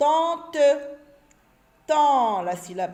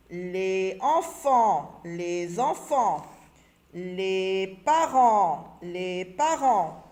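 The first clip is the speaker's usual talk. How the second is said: each word drawn out slowly the first time, then said at normal speed; 4 semitones lower, 4 dB louder, much slower than usual. Shown as a demonstration. Teacher models the words slowly, then says them normally.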